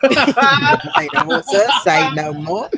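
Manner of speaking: laughing through the words, slow; giggling along at the table; laughing echo. Real, not acted. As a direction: laugh as you repeat the catchphrase. Player echoes the joke, laughing.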